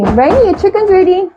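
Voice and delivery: put-on high singsong call to a child. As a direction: summon the kid from another room. Mother calling child to eat.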